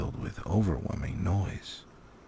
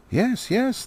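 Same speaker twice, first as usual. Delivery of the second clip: very high, hasty yes; eager young dwarves; 14 semitones higher, 4 dB louder than usual. Acted. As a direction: answer too quickly, eager to please. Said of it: "they said".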